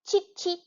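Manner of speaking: high chirping cheep; teacher chirping in the review; bird chirp imitation. Shown as a demonstration. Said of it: "one more time".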